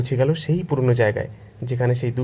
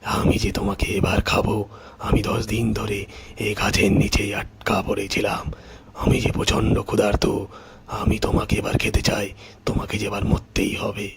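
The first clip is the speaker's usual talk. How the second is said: menacing tiger; 2 semitones lower than usual. Acted. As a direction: threaten your prey as a hungry tiger. Freed tiger threatens the farmer.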